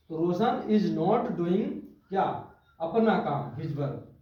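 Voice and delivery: slow classroom dictation. Shown as a demonstration. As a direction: dictate it slowly to students. Teacher dictates the translation.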